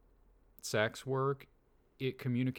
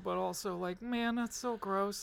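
pained whine; 7 semitones higher than usual. Real, not acted. Torn over Columbo's remark.

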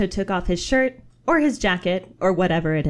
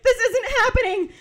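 panicked cry; 3 dB louder than usual. Acted. Elliot panics at Pooh reveal.

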